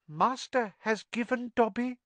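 high squeaky elf voice, amazed; house elf in wonder. Acted. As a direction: squeak it out in awe. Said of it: "said the elf in wonderment".

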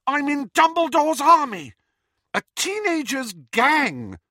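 high, loud, slowing on gang; indignant protest. A narrator's reading. Daughter protesting to her mother, high and indignant. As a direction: insist hotly on your place.

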